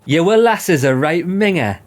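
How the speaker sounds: a laughing Sunderland local's quip; light Sunderland accent; mock Sunderland accent. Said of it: "It's like Sunderland accent".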